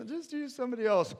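high, loud, hurried whine; pleading Moses. Acted. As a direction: beg off in a high, quick voice. Moses begging off the task.

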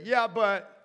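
high, loud mock whine; a petulant yeah but; whiny objection. Preacher voicing Habakkuk objecting to God, mock whiny. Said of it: "he's like".

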